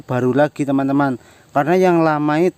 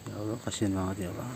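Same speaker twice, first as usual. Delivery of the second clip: low, much softer sigh of pity; pitying murmur; 7 semitones lower, 17 dB quieter, faster than usual. Real, not acted. To viewers as the man walks off: pitying murmur.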